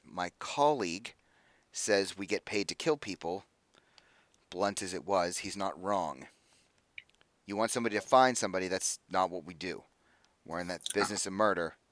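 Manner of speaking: low, soft, measured admission; reluctant. Acted. Mercenary leader admitting her colleague is right, reluctant and subdued. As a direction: back your colleague quietly, against your inclination. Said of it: "there's a reluctancy when she says it".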